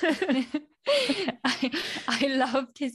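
reading through a fit of giggles; words breaking on a laugh. Real, not acted. Reading a listener's comment aloud, cracking up.

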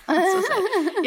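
cracking up while retelling the story; laughing. Real, not acted. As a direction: laugh it out, barely able to speak. Laughing at own made-up excuse.

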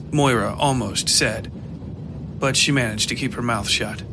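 ordinary delivery

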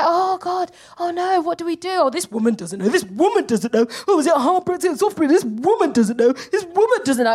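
mildly exaggerated hand-wringing complaint; mock panicked public. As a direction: imitate a flustered crowd wailing. Imitating everyone panicking over Brexit.

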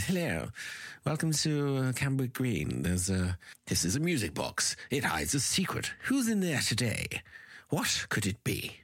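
put-on old-fashioned posh narrator, lightly done; a touch of a plummy 1940s announcer; posh RP voiceover. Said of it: "the voiceover is very R P".

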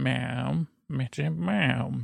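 soft, hushed little meows; cat talking. Pretend. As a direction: meow softly like a sleepy cat. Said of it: "permission to do some cat talking".

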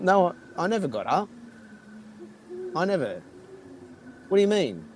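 bewildered retold protest; incredulous denial. Retold denying drunk tent mix-up.

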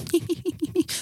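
high, soft tipsy giggle; drunken giggle. Retold or imitated. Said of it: "du blir så här glad och så här fnissig". (fnissande fyllskratt)

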